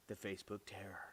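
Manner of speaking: hushed, dramatic title reveal; spooky whisper. Acted. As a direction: whisper it like a scary story title.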